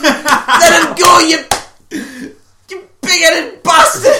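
mock angry shout. Friend ordering Big Head to release Johnny, mock shout. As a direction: holler it with pauses, half laughing. Acts out Bill shouting at attacker.

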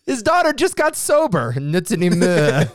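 put-on high, loud French accent; hammy Inspector Clouseau French; bad French accent. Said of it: "in a bad French accent".

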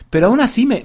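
ordinary talk

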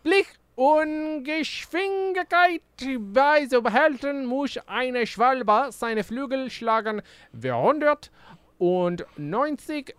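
high, slow, pompous guard voice; pompous castle guard. Acted. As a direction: drag it out like a haughty guard. Reads guard's German lines theatrically.

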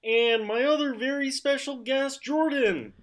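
high froggy puppet announcer; Kermit the Frog. Acted. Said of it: "Kermit the Frog impression".

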